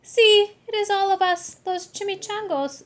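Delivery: high, loud little-dog voice; tiny dog voice. Acted. The smallest dog greeting Scapito, high and loud. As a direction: squeak it out as the smallest pup.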